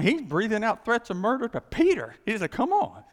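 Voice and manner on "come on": mock threat. Saul threatening Peter.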